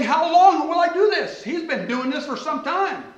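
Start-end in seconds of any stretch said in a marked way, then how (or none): 0.0-1.4 prophet's plea. Retold or imitated. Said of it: "He's saying".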